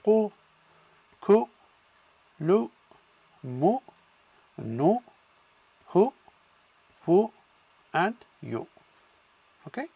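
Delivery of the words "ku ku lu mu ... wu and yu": each syllable "ku", "lu", "mu" clipped and separate with a damma u; Arabic syllable drill. Shown as a demonstration. Teacher drilling students on damma syllables. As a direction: chant "ku, ku, lu, mu, nu, hu, wu, yu" one by one. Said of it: "do some exercises".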